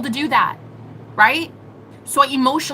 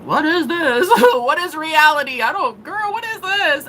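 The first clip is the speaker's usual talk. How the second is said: higher, laughing, astonished mother; amazed mom; 5 semitones higher than usual. Retold or imitated. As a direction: imitate mom laughing in disbelief. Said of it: "my mom was even like".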